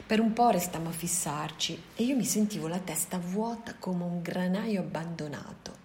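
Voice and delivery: ordinary delivery, nothing marked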